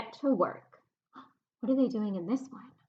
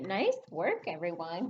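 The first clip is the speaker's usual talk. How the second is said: ordinary talk, nothing marked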